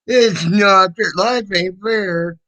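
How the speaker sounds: high, drawn-out taunting whine; mock whining. Parrots the whiner's complaint.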